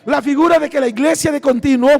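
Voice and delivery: normal talking voice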